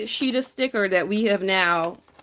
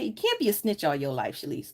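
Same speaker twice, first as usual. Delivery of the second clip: ordinary talk, nothing marked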